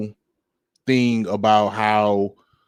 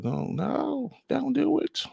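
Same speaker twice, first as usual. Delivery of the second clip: mock pleading cop; 14 semitones higher, 9 dB quieter, slower than usual. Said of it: "what the cop did not tell".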